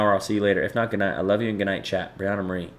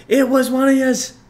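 much higher and louder, cartoonish New York "yous"; squeaky put-on voice; 7 dB louder than usual. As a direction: squeal "yous" like a silly caricature. Repeating line in put-on voice.

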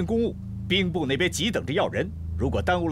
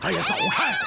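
startled push-off. Acted. Pushed at by brothel girls.

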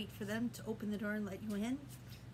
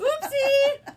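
surprised oopsie; 16 dB louder than usual. Acted. Umbrella mishap, surprised.

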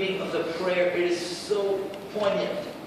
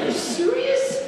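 amazed disbelief; 4 dB louder, slower than usual. Retold or imitated. Disbelief at the girl's recovery.

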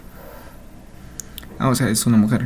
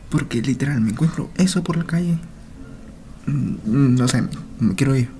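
ordinary talk, nothing marked

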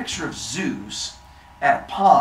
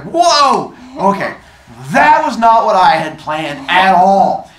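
loud, raised-pitch alarm; shocked; 5 semitones higher, 12 dB louder than usual. Acted. Athena seeing the girl in the tree, loudly taken aback. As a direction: blurt it out in dismay. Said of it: "and she's like".

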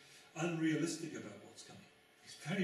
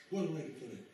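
ordinary delivery

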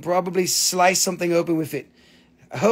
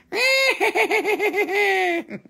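high cackling witch laugh; witch cackle; 12 semitones higher than usual. Pretend. Selling a witch crystal on stream, she cackles high.

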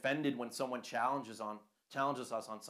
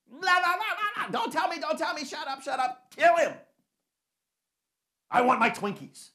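put-on high, loud childish tantrum voice; mock the crowd as a spoiled brat yelling; whiny mob; 10 dB louder than usual. Sanhedrin mocked as whiny crowd.

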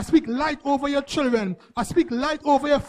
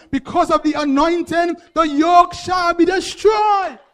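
full force preaching; 3 semitones higher, 6 dB louder than usual. Acted. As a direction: build to a shouted climax, slowing on the last line. Preaching climax about the anointing.

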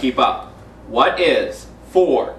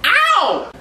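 pained yelp. Pretend. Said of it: "Put your legs behind your head".

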